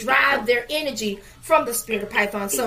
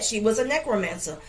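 ordinary talk

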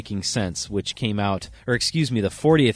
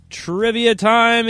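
high, loud and drawn out slowly; hyped announcer call; 12 semitones higher, 9 dB louder, much slower than usual. Real, not acted. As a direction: call it out like a game show host. Host announces the trivia segment.

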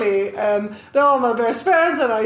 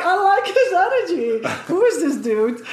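laughing quote; 6 semitones higher than usual. Retold or imitated. Quoting his reaction to guy.

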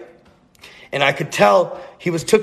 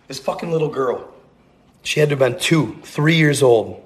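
plain reading voice

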